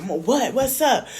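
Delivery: mock him, pushy and taunting; imitating Chef D. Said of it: "I was like".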